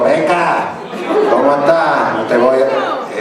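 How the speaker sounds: put-on joking Andalusian accent for a Southern character; mock Andalusian Sawyer. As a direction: play Sawyer as if he were from Andalusia, half laughing. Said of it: "Os imagináis a Sawyer diciendo".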